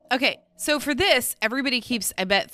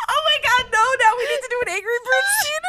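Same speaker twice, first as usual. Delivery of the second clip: high giddy laughing voice; 9 semitones higher, faster than usual. Real, not acted. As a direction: squeeze words out through laughter. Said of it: "I'm fucking crying".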